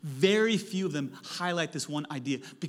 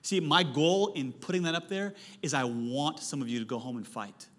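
ordinary delivery

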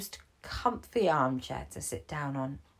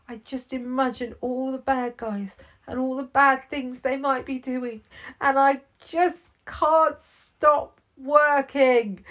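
higher, upset, tired policeman building louder; worn-out Rucky; 9 semitones higher, 8 dB louder than usual. Acted. Rucky confesses to Gibbons he can't stop working, rising upset. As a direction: pour out exhaustion, getting more worked up.

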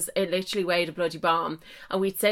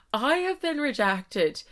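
ordinary talk, nothing marked

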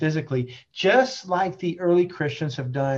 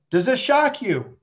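pointed challenge; 8 semitones higher, 6 dB louder than usual. Retold or imitated. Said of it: "And our Lord says".

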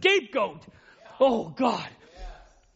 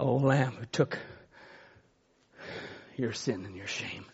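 much softer, lower preaching over music; soft altar call; 12 semitones lower, 9 dB quieter than usual. Acted. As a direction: ease the room into quiet prayer.

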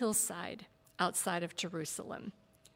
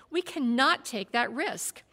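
impatient; 5 semitones higher, 7 dB louder than usual. A narrator's reading. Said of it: "Balthazar said impatiently".

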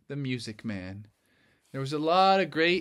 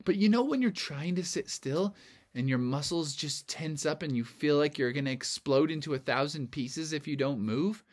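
plain reading voice

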